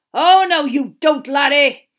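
very loud, high-pitched roar; roaring intervention. Acted. Teacher catching a boy attacking from behind, roaring. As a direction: bellow to stop him in his tracks.

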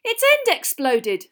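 loud, high, drawn-out complaint; angry. A narrator's reading. Dean showing Hagrid his burn, angrily. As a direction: protest it crossly, raising the voice. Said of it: "said Dean angrily".

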